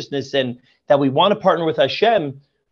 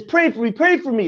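higher-pitched imploring repeat; a hint of frantic begging, raised pitch; desperate plea; 6 semitones higher than usual. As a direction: plead over and over, frantic. Begging heaven and earth.

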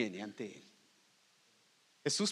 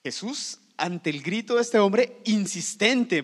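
usual speaking voice

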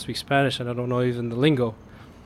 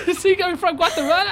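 much higher, laughing buddy greeting; friendly stranger. Retold or imitated. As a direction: greet him like an old pal. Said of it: "to complete happiness and camaraderie".

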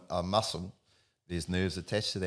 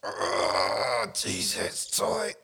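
client groaning; much slower than usual. Retold or imitated. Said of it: "he's here going".

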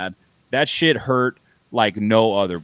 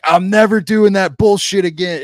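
high, loud furious yell of never again; livid shout; 10 semitones higher, 8 dB louder than usual. Retold or imitated. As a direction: blow up and swear off it for good. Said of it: "I was fucking livid".

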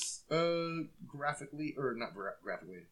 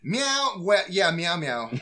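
cat voice; 5 semitones higher, 8 dB louder, slower than usual. Pretend. Playful meowing banter.

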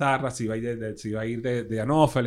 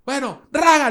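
high, loud mimicry of the guy; voz imitada del tipo; 11 semitones higher, 7 dB louder than usual. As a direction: call it out high and loud as him. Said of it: "El tipo". (imitando al tipo, agudo y fuerte)

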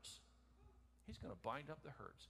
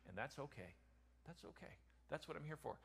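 gentle reassurance; 7 dB quieter than usual. Acted. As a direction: hush it down to comfort.

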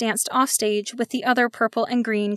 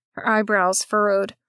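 plain reading voice

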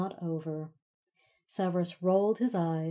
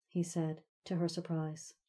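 ordinary delivery

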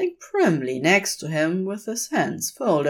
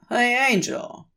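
calling out; 7 semitones higher than usual. A narrator's reading. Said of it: "Crowley calls".

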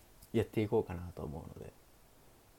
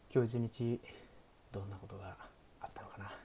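soft, hushed bedtime greeting; sleepy bedtime stream voice; 4 dB quieter than usual. Pretend. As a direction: lull listeners toward sleep, half-whispered. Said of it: "寝落ち配信的なやってみるか". (眠くなるような寝落ち配信の声)

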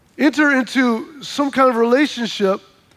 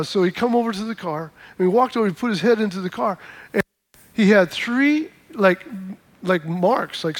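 ordinary talk

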